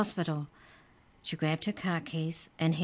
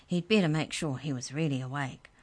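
ordinary delivery

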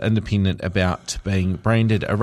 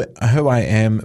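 usual speaking voice

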